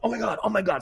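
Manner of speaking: high, startled gasp of being caught; mock caught. Student on air faking being caught, mock startled. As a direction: pretend you've just been busted. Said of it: "I acted like I got caught".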